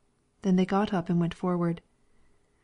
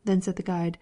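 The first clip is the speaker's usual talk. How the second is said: ordinary delivery, nothing marked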